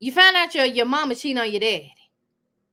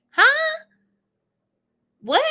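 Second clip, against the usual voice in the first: high shocked huh; 13 semitones higher, 5 dB louder than usual. Real, not acted. Shocked reaction.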